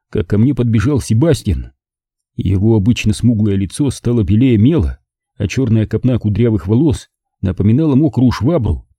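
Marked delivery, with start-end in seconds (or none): none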